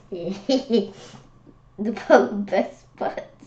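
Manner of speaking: very slow, giggling over the word butt; laughing. Real, not acted. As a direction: savour the silly joke through laughter. Repeats it, laughing.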